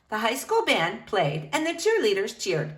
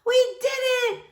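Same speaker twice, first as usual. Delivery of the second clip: much higher, joyful cry; excited yell. Acted. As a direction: shout the victory. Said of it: "someone yelled".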